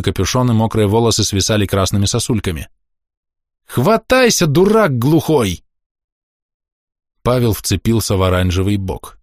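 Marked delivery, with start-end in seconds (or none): 3.7-5.6 yelling. A narrator's reading. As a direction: bellow over the rain. Woman yelling from boat in storm.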